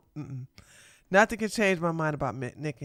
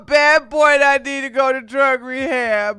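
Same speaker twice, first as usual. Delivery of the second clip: louder, put-on whiny voice pleading like a naughty kid; mock pleading bad boy; 4 semitones higher, 7 dB louder than usual. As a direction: beg for rehab like a child caught out. Co-host mocks Nick's plea.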